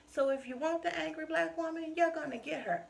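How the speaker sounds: defiant challenge. Real, not acted. Challenge to those who label her.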